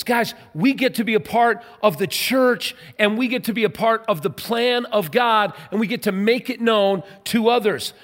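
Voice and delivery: rousing sermon delivery; fervent preaching. Acted. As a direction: proclaim it to the whole congregation. Preaching at full force.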